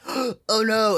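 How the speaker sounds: gasp, then a high "oh no"; hammed-up movie shock; mock alarm. Senator spotting the jar before the blast, alarmed.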